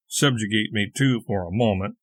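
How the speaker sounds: ordinary delivery, nothing marked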